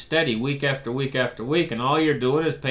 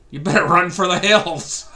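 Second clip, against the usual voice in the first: laughing; 3 semitones higher, 4 dB louder than usual. Real, not acted. Laughing at the coming collapse.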